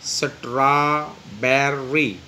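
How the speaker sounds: Strawberry slowly split as straw, ber, ry; syllable by syllable. Shown as a demonstration. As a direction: stretch strawberry into three separate syllables. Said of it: "इसमें भी तीन syllable होंगे". (Strawberry کو تین حصوں میں آہستہ توڑ کر بولیں)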